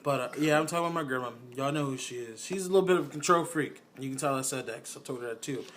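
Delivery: ordinary talk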